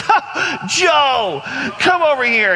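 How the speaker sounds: gasp, then high called-out welcome; exuberant greeting. Retold or imitated. George welcoming newcomer at the door, high exuberant shout. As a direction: hail a newcomer like a long-lost friend.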